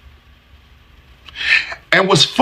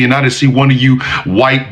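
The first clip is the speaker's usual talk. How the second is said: ordinary talk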